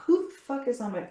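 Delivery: mock angry yell. Shown as a demonstration. Coach acting out a startled yell mid-demo. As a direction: yell at an intruder, then stop short. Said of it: "if you're not".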